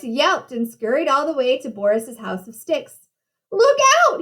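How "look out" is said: high, urgent storybook shout; warning cry. A narrator's reading. Horace flees to his brother's house, high warning cry. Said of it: "he cried".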